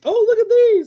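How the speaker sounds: high, loud squeal of delight; mock excited delight. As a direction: gush like you've found a treasure. Acting delight at old commercials.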